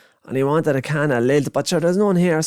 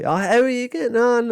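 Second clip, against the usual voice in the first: put-on kid's rant; 13 semitones higher than usual. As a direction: splutter like an outraged little kid. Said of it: "chatting to everyone".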